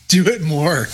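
laughing. Real, not acted. Laughing request.

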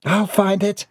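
high-pitched eager offer; high little character voice; eager helper. Acted. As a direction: pipe up high to volunteer. Woman eagerly offers help.

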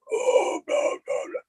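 unvoiced death metal growl; guttural demon voice. Shown as a demonstration. Showing the co-hosts a metal singer's voice, guttural demon growl. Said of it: "got the guttural, like, demon voice".

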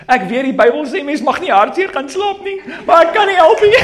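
very high, loud, laughing mock wail; imitating sobbing daughter. Father imitating his daughter crying that she can't help going to bed sad. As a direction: sob it out like a heartbroken little girl. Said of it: "Now she's crying. She says".

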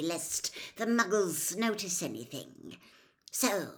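loud, emphatic villain voice; cold master berating a servant. Acted. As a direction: press hard on key words, scornful of the fool.

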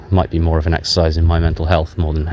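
ordinary talk

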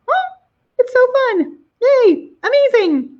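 high, slow excited squeal. Real, not acted. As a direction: squeal with delight. Gushing over the live show.

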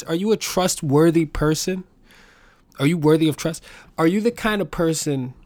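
ordinary talk

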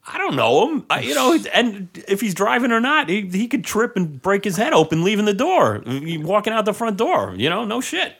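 high, loud, incredulous protest; retelling a bar crowd, indignant high protest; exasperated. Retold or imitated. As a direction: plead your innocence, voice up high.

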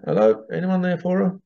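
hamming up dialling the spirit world; mock phone call to spirit. Psychic mock-calls the spirit world for the client, playful pretend phone voice. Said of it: "let me get the old psychic spiritual, uh, telephone out".

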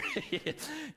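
a real laugh breaking out; high burst of real laughter. Real, not acted. Preacher laughing at a listener's reply, real laughter. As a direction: laugh freely at the joke.

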